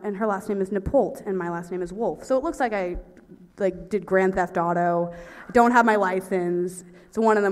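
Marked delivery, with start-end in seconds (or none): none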